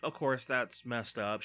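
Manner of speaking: normal talking voice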